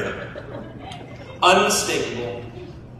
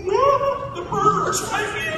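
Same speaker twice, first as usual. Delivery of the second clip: high, panicky squeal; mock panic, voice shooting up; panicked; slower than usual. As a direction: shriek it like you're scared stiff. Nervous couple fearing the murderer.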